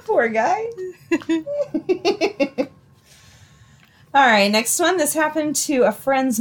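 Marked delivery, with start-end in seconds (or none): 0.7-2.6 soft high laugh; fading giggle. Real, not acted. Laughing after punchline.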